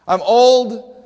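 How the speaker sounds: Zechariah's loud, insistent protest; emphatic complaint. Retold or imitated. Old priest protesting to Gabriel, loud and emphatic. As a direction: blurt it out, fed up with being old. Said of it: "It's an emphatic statement".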